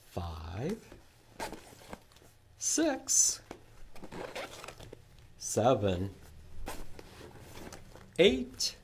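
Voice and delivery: slow counting, pauses between numbers; counting with kids. Acted. Librarian counting aloud with children, slow and sing-song.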